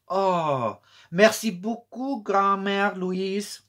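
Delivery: put-on a French boy thanking his grandmother; French thanks. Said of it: "which is French for".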